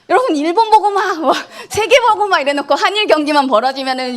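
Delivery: high-pitched words broken up by laughter; laughing. Real, not acted. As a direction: laugh through the words while you tease.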